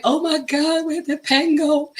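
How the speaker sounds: lost pen panic, lightly; mock dismay. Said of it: "I'm like".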